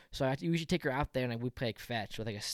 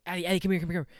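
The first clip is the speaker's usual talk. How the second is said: retold urgent call, higher and louder; calling the dog; 5 semitones higher, 5 dB louder, much faster than usual. Owner calling Addie away from another dog, urgent calling. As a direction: call your dog back in a hurry. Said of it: "calling her name".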